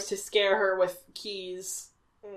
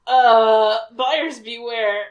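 ominous warning; 8 dB louder, much slower than usual. Pretend. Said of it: "dramatic slow tagline".

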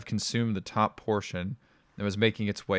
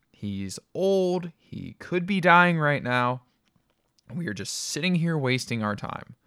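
urgent plea; 7 semitones higher, 4 dB louder than usual. A narrator's reading. Urgent plea about dying father.

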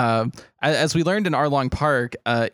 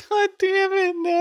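high laughing groan; laughing protest. Real, not acted.

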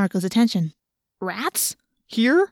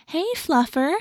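higher, sing-song voice for a cat; high pet voice; 7 semitones higher than usual. A narrator's reading. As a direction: talk to the kitty like a baby. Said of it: "in a high talking to animals voice".

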